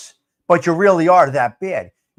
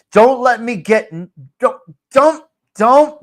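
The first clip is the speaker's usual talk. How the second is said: rising exasperation; 4 semitones higher, 5 dB louder than usual. Real, not acted. Warning himself, getting worked up.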